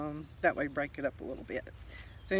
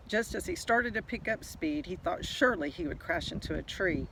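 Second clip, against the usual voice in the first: usual speaking voice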